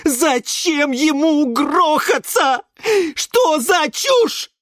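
high, slow squeals of outrage; indignant shout. Acted. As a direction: shout each question in disbelief. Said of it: "и крикнул".

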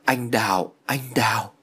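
half-whispered call of the name; barely conscious, breath leading into voice; faint delirious mumble. A narrator's reading. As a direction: murmur it as if unconscious. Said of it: "miệng của Thiện vẫn đang mấp máy".

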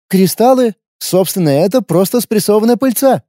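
wary fighter, blunt and guarded; young warrior woman. A narrator's reading. Lina explains.

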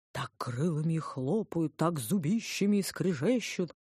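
slow, drawn-out spooky relish; old storyteller. A narrator's reading. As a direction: mimic an old man hyping up monsters. Narrator quotes the old man's monster tale. (протяжно, смакуя ужасы, как дед-рассказчик)

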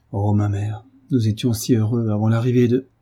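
ordinary delivery, nothing marked

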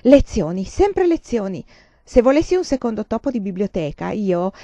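loud, exasperated scolding; mother clapping sharply at daughter, exasperated. Acted. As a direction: snap it out, fed up. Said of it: "batté seccamente le mani".

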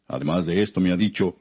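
plain reading voice

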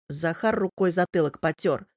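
ordinary delivery, nothing marked

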